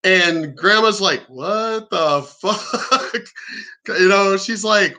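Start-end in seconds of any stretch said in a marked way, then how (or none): 1.3-3.2 drawn-out, stunned disbelief; slow mock astonishment; stunned grandma. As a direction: imitate a flabbergasted grandma, stretching each word.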